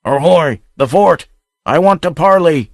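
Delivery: high, loud bellow across the distance; bull's bellow. A narrator's reading. Pirate hailing the fort from a distance, bellowing. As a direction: hail the fort from afar. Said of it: "shouted in a bull's bellow".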